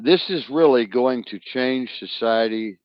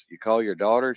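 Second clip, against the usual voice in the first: ordinary talk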